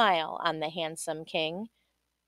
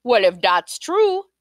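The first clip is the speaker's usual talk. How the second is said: king's voice; 3 semitones higher, 7 dB louder than usual. Acted. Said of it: "said the king".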